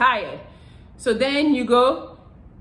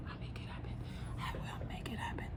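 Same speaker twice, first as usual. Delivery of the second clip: whisper; 21 dB quieter, faster than usual. Real, not acted. Teasing sign-off whisper to viewers.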